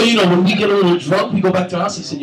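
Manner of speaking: usual speaking voice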